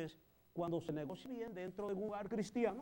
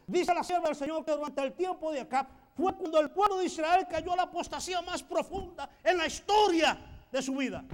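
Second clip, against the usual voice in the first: louder preaching, pushing every phrase; preaching at full force; 5 semitones higher, 11 dB louder than usual. Acted.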